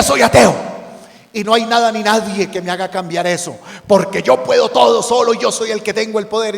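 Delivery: loud, high, proud boast; a cocky atheist shouting his defiance; defiant atheist. Acted. Boastful atheist quoted in sermon.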